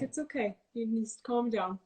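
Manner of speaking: softly voiced calming mom. Being gentler with herself while meditating, soft mom voice. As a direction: hush it gently, like a mom settling a child. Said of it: "mom voice. I'm like".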